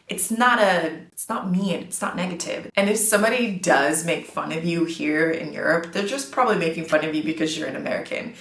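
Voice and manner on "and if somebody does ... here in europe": usual speaking voice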